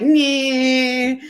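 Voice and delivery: partner's cheeky mock grunt; mocking nyeh. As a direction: blow him a playful nyeh. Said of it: "So he's like".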